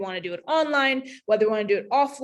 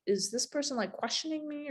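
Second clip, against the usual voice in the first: suspicious; 5 semitones higher, 8 dB quieter than usual. Retold or imitated. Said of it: "come across as".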